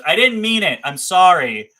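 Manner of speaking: loud, high, urgent sorry; pleading apology. Real, not acted. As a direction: blurt out the apology in a rush. Apologizing to the buyer he insulted.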